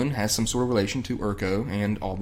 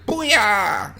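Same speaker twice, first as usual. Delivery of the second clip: high, drawn-out "booyah"; imitated outburst; 10 semitones higher than usual. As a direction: blurt it out like a cartoon sound effect. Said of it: "you're gonna hear".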